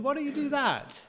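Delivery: mock know-it-all. Know-it-all helper in kitchen.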